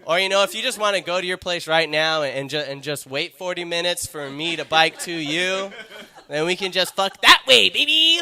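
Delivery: hammed-up sleazy come-on; creepy seductive voice. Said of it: "It's that voice again".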